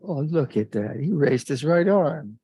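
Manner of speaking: playfully awestruck, with big pitch swoops; acted admiration. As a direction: marvel aloud, stretching out the praise. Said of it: "and I thought".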